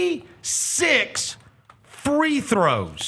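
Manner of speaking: slow, high, emphatic disbelief; outraged. Real, not acted. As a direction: spell out the numbers slowly in outrage. Repeats the stat in outrage.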